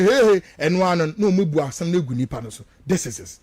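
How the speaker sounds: usual speaking voice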